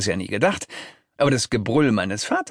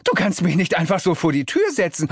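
raised, stammering objection; acted outrage, pitched up; indignant protest; 7 semitones higher than usual. A narrator's reading. Protesting at being thrown out.

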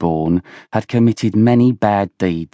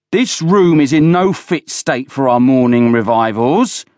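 high-pitched, forceful telling-off; angry scolding; 6 semitones higher, 4 dB louder than usual. A narrator's reading. Villiers berates Oland over the room, angry scolding.

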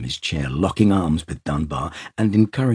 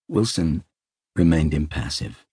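plain reading voice

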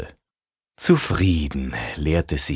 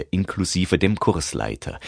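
usual speaking voice

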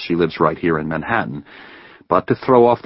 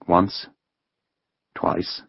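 ordinary delivery

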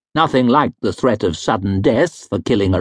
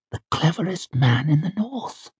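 soft flattering voice; 5 semitones higher, 6 dB quieter than usual. A narrator's reading. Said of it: "soft, low, urgent voice".

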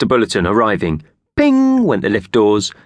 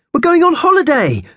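high squeaky loud cheer; excited mouse voice; 6 dB louder than usual. A narrator's reading. Purvis the mouse whooping about going on holiday. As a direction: bounce with glee as a little mouse.

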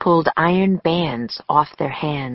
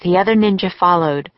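ordinary delivery

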